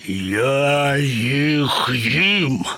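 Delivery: very slow, menacing lion growl; roaring lion. Acted. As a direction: stretch each word into a threatening roar. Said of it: "проривів Лев". (протяжний грізний рев лева)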